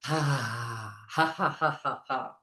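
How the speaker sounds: long "ha" breaking into short ha-has; breathing-exercise laugh, tailing off; exhaled laugh. Shown as a demonstration. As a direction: let the out-breath turn into deliberate laughter. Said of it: "Once more".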